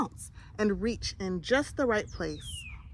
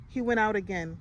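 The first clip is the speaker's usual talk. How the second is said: usual speaking voice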